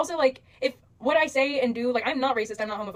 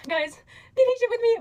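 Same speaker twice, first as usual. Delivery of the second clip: mock plea; 11 semitones higher, 3 dB louder than usual.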